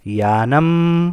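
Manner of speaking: Yanam stretched out slowly and clearly; slow model pronunciation. Shown as a demonstration. Teacher naming the vehicle for pupils, slow and deliberate. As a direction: spell out Yanam slowly by sound. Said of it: "गाड़ी है".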